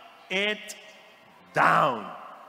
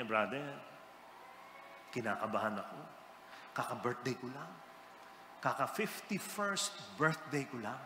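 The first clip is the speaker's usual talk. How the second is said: hushed nervous confiding; 6 semitones lower, 11 dB quieter than usual. Retold or imitated. Retold nervous man confiding.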